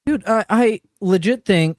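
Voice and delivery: ordinary talk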